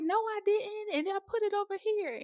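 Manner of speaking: put-on high child's denial; protesting niece. Niece denying blame to her mother, high mock protest. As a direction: pitch it way up, imitating a kid's protest.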